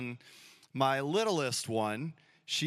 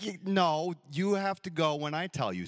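put-on bossy little girl; 3 semitones higher than usual. Daughter setting the rules.

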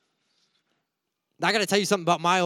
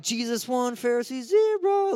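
playful, much higher sportscaster call of the score; mock scoreboard cheer; 7 semitones higher than usual. Preacher calls Jesus's win over the Pharisees, mock sportscaster cheer. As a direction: announce it like a game score, gleefully. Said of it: "I'm like".

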